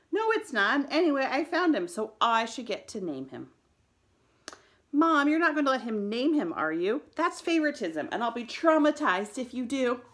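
put-on loud, high-pitched indignant kid; heated sibling protest. A narrator's reading. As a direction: argue back loudly, outraged at the unfairness. Brothers quarrel over naming the rabbit.

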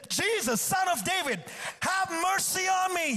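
high-pitched, urgent cry for mercy; imitated desperate shouting; acted shout. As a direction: call out over a crowd, pitch pushed well up. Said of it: "He shouted even louder".

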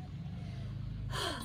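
long unvoiced tired sigh; big sigh. A narrator's reading. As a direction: heave a weary breath. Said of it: "did a big breath".

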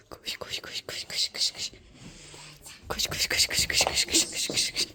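breathy urgent whisper of koş. Pretend. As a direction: hurry them along in a whisper. Hide and seek, running.

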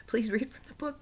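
high whispered plea; pleading whisper. Retold or imitated. Child begging grandfather.

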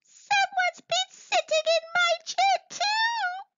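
very high, tearful cub voice; sobbing bear cub. Acted. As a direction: whimper it like a heartbroken cub. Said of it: "sobbed Baby Bear".

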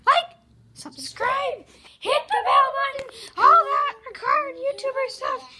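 loud, slow call to action; shouted sign-off. Pretend. Closing the video for subscribers, shouted sign-off. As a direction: belt out each request.

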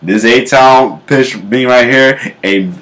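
normal talking voice

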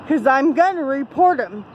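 ordinary delivery

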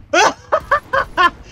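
high, loud burst of laughter; laughing. Real, not acted. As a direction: crack up in high, pulsing laughs. Cracks up over his own remark.

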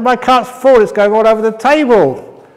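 a guest losing patience, raising his voice; irritated. Acted. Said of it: "I said, I said".

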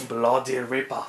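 put-on low, muted Australian accent; bad Australian accent. Hobbyist poking fun at own mimicry, in a poor Australian accent. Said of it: "an Australian impression".

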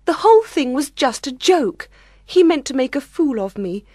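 high, upset bride's voice; acted humiliated lament; close to tears. A narrator's reading. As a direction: complain in a high voice, working up to tears. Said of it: "She began to cry".

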